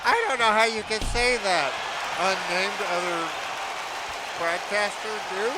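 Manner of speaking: rival commentator. Acted. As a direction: argue back as the second commentator. Arguing as the second commentator.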